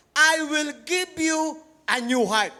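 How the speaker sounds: solemn scripture proclamation; scripture reading. Acted. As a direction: proclaim the verse slowly. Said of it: "The Bible says".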